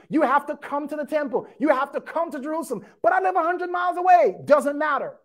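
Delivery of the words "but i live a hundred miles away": high, fast, shouted objection from an imagined listener; mock protest. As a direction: play the whiny objector who lives far away. Preacher voices a protesting listener.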